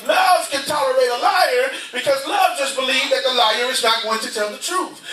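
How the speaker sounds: ordinary talk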